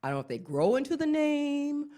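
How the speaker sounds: ordinary delivery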